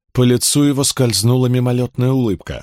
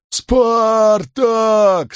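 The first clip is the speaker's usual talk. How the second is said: roaring crowd; 11 semitones higher than usual. Acted. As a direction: bellow his name like a crowd. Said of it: "снова взревели рабы".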